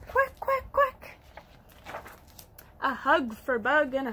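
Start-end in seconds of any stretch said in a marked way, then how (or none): 0.1-1.2 high, loud duck quacks; happy duck quacking. Acted. Ducky showing children how happy sounds, high quacking. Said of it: "When I'm feeling happy, I say".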